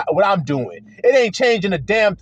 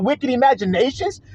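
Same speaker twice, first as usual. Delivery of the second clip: ordinary delivery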